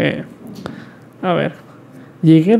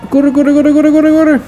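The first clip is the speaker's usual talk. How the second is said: high urgent shouts; frantic yelling; 8 semitones higher than usual. Real, not acted. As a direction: shout at them to run. Fleeing police again.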